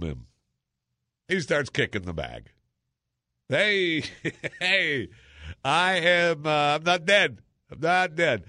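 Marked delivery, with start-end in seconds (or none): 3.5-8.4 1 man calling from body bag. Retold or imitated.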